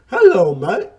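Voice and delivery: loud hammed-up mock Aussie greeting; terrible Australian accent. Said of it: "my terrible impression of Australian accent".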